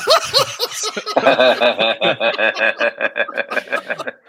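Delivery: long, loud burst of laughter; real laughter. Real, not acted. Co-host cracking up at the trauma question, loud laughing. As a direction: crack up helplessly and keep it going.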